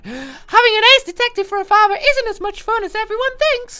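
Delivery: put-on high, bright-eyed girlish voice, loud and quick; high anime girl voice; anime schoolgirl voice. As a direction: play the sleepy student heroine in a high cute voice.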